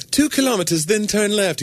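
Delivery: high prim navigation voice; GPS lady voice. Retold or imitated. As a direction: imitate a polite female GPS. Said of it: "she'd be like".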